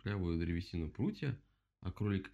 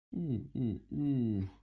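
three hoots, raised in pitch; owl hooting; 4 semitones higher, 4 dB louder, much slower than usual. A narrator's reading. Owl feels the bump on her head, hooting. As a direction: hoot like an owl coming round. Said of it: "ухнула сова".

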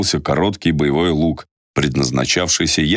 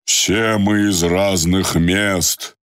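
shouting over wind; 7 semitones higher, much slower than usual. Acted. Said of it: "из последних сил крикнул сквозь ветер".

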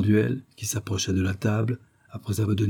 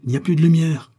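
raised, loud call; shouted report; 8 semitones higher, 6 dB louder than usual. A narrator's reading. Agent outside to those within, shouting. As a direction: call it out from a distance. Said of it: "cria un agent du dehors".

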